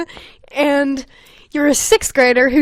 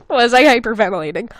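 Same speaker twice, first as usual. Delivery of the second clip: normal talking voice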